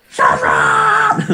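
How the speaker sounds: sudden high-pitched yell; shouting. Acted. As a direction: scream it out angrily. Comic Dragon Ball shouting match.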